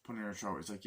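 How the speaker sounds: ordinary talk, nothing marked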